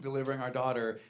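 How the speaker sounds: ordinary talk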